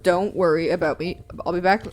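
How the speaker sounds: usual speaking voice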